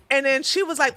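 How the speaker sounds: ordinary talk, nothing marked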